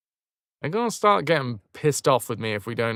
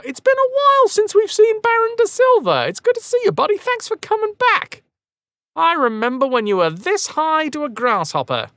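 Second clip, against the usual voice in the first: high, loud overly friendly voice; mock friendly greeting; 14 semitones higher, 6 dB louder than usual. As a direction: greet the old rival sweetly. Mock-friendly greeting to enemy captain.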